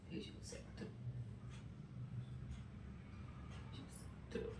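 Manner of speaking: barely audible, nearly whispered; hushed, hurt repetition. Real, not acted. Telling the lover they used and discarded her, hushed. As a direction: let the voice fade almost to nothing. Bitterly repeats being used, voice drops away.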